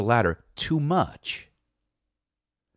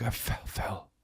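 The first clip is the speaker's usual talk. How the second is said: weak dazed wife; 13 dB quieter, slower than usual. Retold or imitated. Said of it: "She's like".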